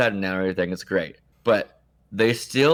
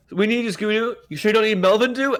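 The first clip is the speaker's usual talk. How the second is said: squeaky put-on caller voice, ham it up; high mock phone voice; 10 semitones higher than usual. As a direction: play the caller with a high silly voice. Acted phone call.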